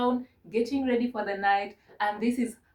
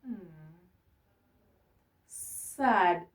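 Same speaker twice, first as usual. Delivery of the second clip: slow, downcast "You're just sad"; sad demo; 3 semitones lower, much slower than usual. Shown as a demonstration. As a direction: drag it out, sounding sad. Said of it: "this is how you look when you're sad".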